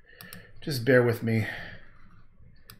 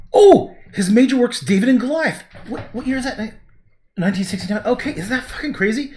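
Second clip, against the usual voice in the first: sudden loud, high burst of amazement; excited surprise; 11 semitones higher, 8 dB louder than usual. Real, not acted. Painter reading about his old patron, loud amazed outburst. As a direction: blurt it out, truly astonished. Stunned by Wikipedia find.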